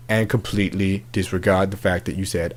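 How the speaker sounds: usual speaking voice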